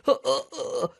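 high, strained dying gasps; wounded soldier gasping. Retold or imitated. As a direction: choke out the last breaths. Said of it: "looking at you like".

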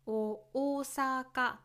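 「大阪」 demonstrated in standard pitch, stepping up after オ; 標準語の大阪. Shown as a demonstration. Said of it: "スタンダードジャパニーズでは、". (標準語式に低く入って上げる「大阪」)

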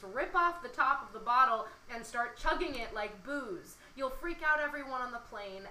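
lively spoken-word poem recital; poem reading. Acted. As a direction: perform the poem to the listener. Reads her love poem aloud.

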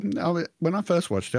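ordinary talk

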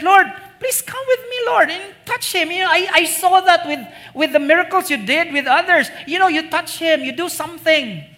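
high, pleading plea for a touch; begging official. Retold or imitated. Official begs Jesus to come touch his son, high pleading.

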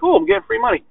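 high-pitched naive glee, sent up; mock delight. Podcaster mocking people pleased with the tax credit, in high mock delight.

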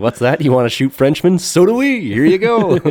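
high, loud mock salesman, climbing to a squeal; gleefully hammed-up sales pitch; eagerly obliging seller. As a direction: play an over-eager arms dealer, pitch soaring. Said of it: "The Dutch were happy to oblige".

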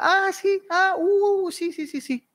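very high absent-minded uh-huhs; distracted dad. Dad ignoring child's pleas, high distracted murmurs. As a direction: brush the kid off, high and vague.

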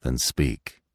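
low, quiet command; a hint of a stern old stag ordering. A narrator's reading. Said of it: "the old stag commanded".

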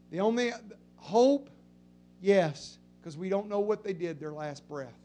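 very soft, low and grave; almost hush it out; hushed solemn. Real, not acted. Pastor on funerals, hushed and low.

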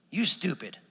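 imitating a Texan father-in-law; short mock-Texan jab; Texan accent. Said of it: "in his best Texan accent".

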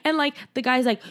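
ordinary delivery

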